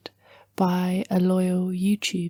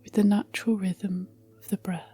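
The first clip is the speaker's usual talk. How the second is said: guided meditation; 9 semitones lower, 8 dB quieter than usual. Acted. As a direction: gently guide the listener into relaxation, phrase by phrase.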